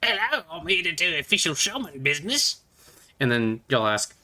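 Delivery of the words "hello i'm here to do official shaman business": higher, pompous goblin greeting; a high, self-important goblin voice; official goblin shaman. Acted. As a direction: announce yourself as the shaman, pitched up. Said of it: "he's gonna walk up to you and go".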